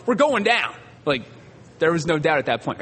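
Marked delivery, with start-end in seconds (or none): 0.1-0.7 mild mock yell. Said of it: "the pilot burst out of the cockpit and yelled".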